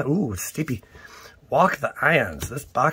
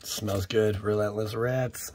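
ordinary delivery

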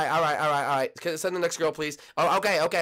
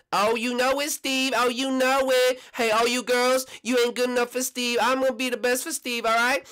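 loud, higher-pitched boasting; brash, raised contestant voice; cocky tennis contestant; 11 semitones higher, 5 dB louder than usual. Acted. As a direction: crow over the rivals.

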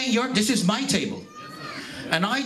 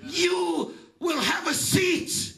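higher, shouted gospel preaching with slow stretched calls; preaching at full force; 5 semitones higher than usual. Acted. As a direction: call out to the congregation like a revival preacher. Preaching climax, seats for every nation.

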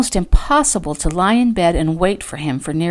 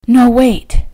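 high, loud, urgent plea; urgent breathed plea; 7 semitones higher, 8 dB louder than usual. A narrator's reading. Fallon stopping him from leaving, urgent. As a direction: stop him with sudden urgency. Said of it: "she breathed".

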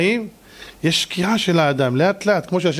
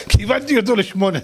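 laughing; 6 semitones higher than usual. Real, not acted. Laughs through the punchline.

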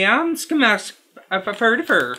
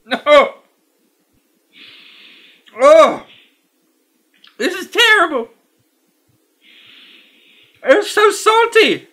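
high, loud squeals of disgust over each bite; disgusted outcry; 8 semitones higher, 5 dB louder, slower than usual. Real, not acted. Reviewer tasting awful salty licorice, high loud disgusted cries. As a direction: yelp it out like the candy is burning your mouth.

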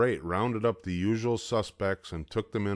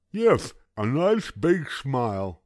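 put-on high, loud gummy old man; toothless grandpa; 6 semitones higher, 5 dB louder, slower than usual. A narrator's reading. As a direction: ask suspiciously like a toothless grandpa.

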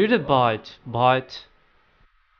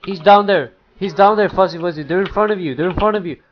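loud, raised, repeated warning; urgent callout; 3 semitones higher, 7 dB louder, faster than usual. Real, not acted. Warning Fuzzy Wuzzy of an enemy, urgent callout. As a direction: call it out urgently to a teammate. Calling enemy position to teammate.

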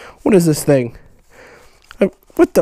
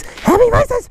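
high squeal; slower than usual. Acted. Repeats as a squeal.

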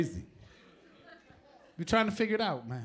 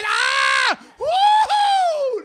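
high, loud whooping sex noise; mock ecstatic yell; 12 dB louder, much slower than usual. Comic acting out noisy sex, whooping loudly.

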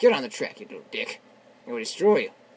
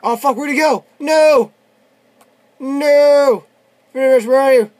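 loud, raised-pitch yells; frustrated shouting; 8 semitones higher, 13 dB louder, slower than usual. Real, not acted. Gamer who lost sight of the target, frustrated shouting. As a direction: shout in alarm as the target gets away. Loses track of game target.

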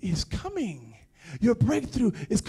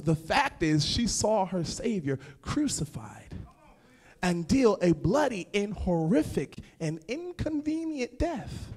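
normal talking voice

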